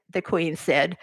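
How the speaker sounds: ordinary delivery, nothing marked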